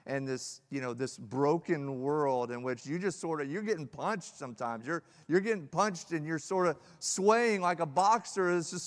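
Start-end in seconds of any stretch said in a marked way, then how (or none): none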